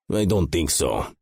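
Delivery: a hint of a Russian accent, sneering; lightly voiced Russian thug, unbothered. A narrator's reading. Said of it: "Vitali said in that fucking annoying accent".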